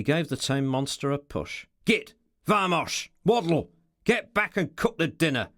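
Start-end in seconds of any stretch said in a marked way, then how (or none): 1.8-5.5 sharp raised bursts, rough and impatient; rough, angry orders. A narrator's reading. Ricardo shoves the tame monster off to cook, rough angry orders. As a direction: fire off each command angrily, higher and louder. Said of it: "in a rough, angry voice".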